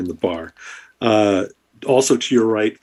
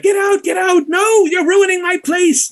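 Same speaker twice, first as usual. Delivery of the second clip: shrieking barkeep. Acted. Barmaid yelling at brawlers in her inn, high panicked shout. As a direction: yell at the brawlers wrecking her bar. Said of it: "The, uh, woman behind the bar is like".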